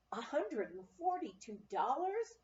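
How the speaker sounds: ordinary delivery, nothing marked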